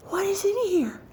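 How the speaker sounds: very high, mock-horrified question; high-pitched, hammed-up alarm. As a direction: squeak it out in retold dismay. Retold alarmed question to Vicky.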